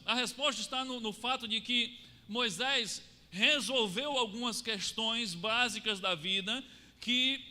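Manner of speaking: normal talking voice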